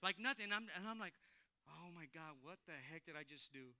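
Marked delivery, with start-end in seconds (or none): 1.7-3.8 very soft, lower aside to oneself; hushed self-talk. Retold or imitated. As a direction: say it under your breath, as if stunned. Acts out stunned self-talk, alone.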